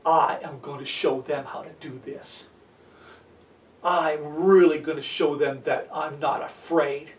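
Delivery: low, soft thinking to himself; inner thought. Acted. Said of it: "he thought".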